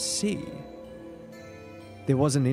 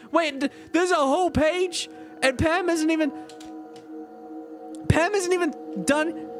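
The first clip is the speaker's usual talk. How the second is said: high, disbelieving outburst; can't believe how long this is; incredulous; 12 semitones higher than usual. Real, not acted.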